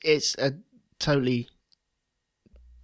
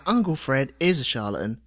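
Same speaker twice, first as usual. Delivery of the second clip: light Cockney. Retold or imitated. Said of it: "All right, geezer".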